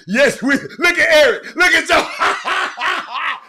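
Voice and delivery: high, loud, laughing words; laughing holler. Real, not acted. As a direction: crack up while calling out. Cracks up at the others' reaction.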